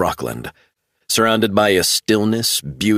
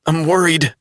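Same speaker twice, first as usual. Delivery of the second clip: worried; 10 semitones higher than usual. A narrator's reading. Travell frightened.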